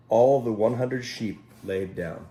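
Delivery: ordinary delivery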